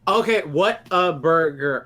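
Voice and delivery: slow, careful "what a burger". Shown as a demonstration. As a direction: spell out "what a burger" slowly. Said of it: "I slowed it down".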